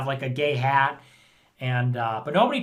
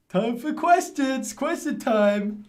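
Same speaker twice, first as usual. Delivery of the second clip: higher, slow sing-song Question time; mock high announcement; 7 semitones higher, slower than usual. Shown as a demonstration. Streamer mocking question time, high and sing-song. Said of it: "mocking the phrase".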